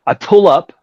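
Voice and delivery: ordinary delivery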